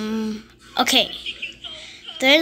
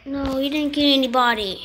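ordinary talk, nothing marked

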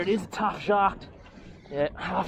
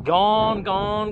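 ordinary talk

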